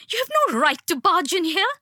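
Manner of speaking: high, fast frantic yell. A narrator's reading. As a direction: rattle it off at him, furious and scared. Mother keeps yelling at intruder.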